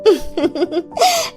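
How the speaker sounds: soft, high witchy giggle; sly chuckle. Acted. Doll seller arriving to claim the doll, sly chuckle. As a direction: snicker softly and high.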